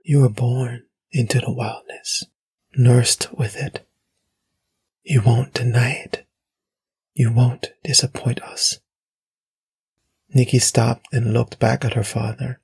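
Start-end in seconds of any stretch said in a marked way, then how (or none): 0.0-8.8 lightly eerie, insistent murmur; soft mysterious voice. A narrator's reading. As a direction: press it home in hushed, spaced phrases. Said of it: "soft, like a woman's, rumbling".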